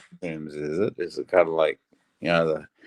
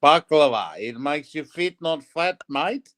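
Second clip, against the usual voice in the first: put-on Greek Aussie accent; 6 semitones higher than usual. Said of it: "the Greek Aussie accent is brilliant".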